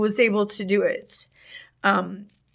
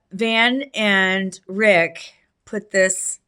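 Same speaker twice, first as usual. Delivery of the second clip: ordinary talk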